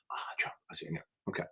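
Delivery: muttered under the breath, voiceless; a quick private whisper to himself; whispered aside. Real, not acted. Tutor whispering a side joke to himself.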